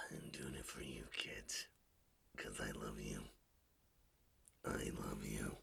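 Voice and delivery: soft unvoiced whisper, low. Father whispering to his kids, soft whisper. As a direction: breathe it to the kids tenderly.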